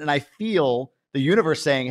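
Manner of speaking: ordinary delivery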